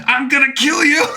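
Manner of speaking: high, loud hammed-up threat; Ocelot's furious threat. Disarmed soldier charging with a knife, high loud mock threat. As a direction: yell it like a cocky villain charging in. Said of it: "he's like".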